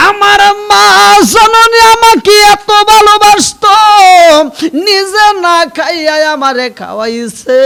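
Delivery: very loud, high chanted cry; impassioned preacher's lament, held endings; anguished wail. Acted. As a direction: wail out the son's grief at full voice. Preacher cries out about mother's love.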